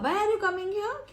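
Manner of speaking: high, slow puzzled ask; surprised question. Shown as a demonstration. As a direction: ask like a visit was unexpected. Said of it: "जैसे पूछते हैं ना". (ऊँची, धीमी हैरानी भरी पूछ)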